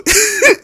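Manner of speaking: very high, bleating laugh; squeaky bleating laugh; high goat laugh. Retold or imitated. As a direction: imitate a cousin's billy-goat giggle. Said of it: "this really high pitched laugh".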